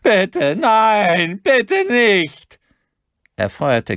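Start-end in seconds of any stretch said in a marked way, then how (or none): 0.0-2.6 very high, loud pleading shriek; hysterical, terrified plea; shrieked begging. A narrator's reading. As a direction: screech for mercy. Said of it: "kreischte der Mann".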